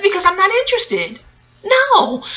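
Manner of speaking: high, sharp mock refusal; teen girl refusing the older man; firm refusal. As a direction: shut him down with a high no. Refusing the man in retold scene.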